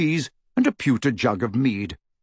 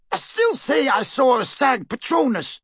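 shouted; 13 semitones higher than usual. A narrator's reading. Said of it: "shouted the first Death Eater".

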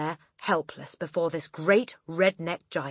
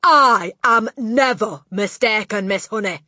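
overbearing headmistress; 5 semitones higher, 9 dB louder than usual. A narrator's reading. Headmistress rebuking a teacher, loud and imperious. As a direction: come down hard as a domineering headmistress.

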